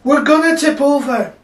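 high, loud shout of alarm; panicked cry. Acted. Sailor fears the boat will tip over, panicked cry. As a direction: yell it in acted panic. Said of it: "cried another one".

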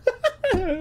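sudden high cackle; real laugh breaking out; high burst of laughter. Real, not acted. Laughing at own joke.

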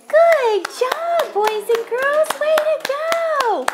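high cheerful praise; excited. Real, not acted. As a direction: cheer them on brightly. Praising children.

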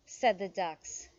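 animated storybook reading with lively pitch swings; story read to children. A narrator's reading. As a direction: read it aloud for little listeners. Reads picture book aloud to children.

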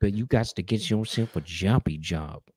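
soft laid-back rapper voice. Acted. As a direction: imitate a rapper handing out casual advice. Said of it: "This is [name]".

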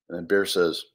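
usual speaking voice

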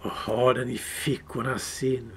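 suspicious creature drawing out words; Gollum hissing. Acted.

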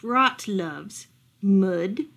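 usual speaking voice

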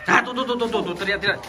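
panicked. Pretend. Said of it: "Kamu panggilnya panik-panik".

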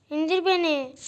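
usual speaking voice

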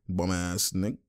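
low, soft muttered jab; muttered imitation. Streamer voices the player who scored on him, muttered. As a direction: say it under your breath as the player. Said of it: "and he's like".

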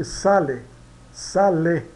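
sale with a clear final e, not i; Italian word demo. Shown as a demonstration. Teacher shows the Italian final e to students, clear and slow. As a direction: spell out sale so the last e rings as e. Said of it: "A gente diz sale e não".

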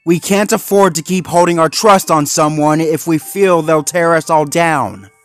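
loud, high, insistent argument; urgent warning. Acted. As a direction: warn of the danger forcefully. Zuma warns Marshall urgently.

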